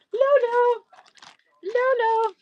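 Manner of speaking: high singsong call across the room. Real, not acted. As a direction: call her name up high to get her attention. Calling her friend across room.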